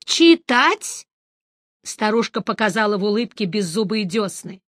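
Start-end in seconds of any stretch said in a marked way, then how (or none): 0.0-1.1 high, creaky granny asking back; old crone echoing the word; old woman voice. Acted. Old woman surprised at question.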